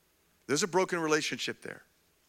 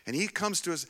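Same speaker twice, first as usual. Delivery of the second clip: usual speaking voice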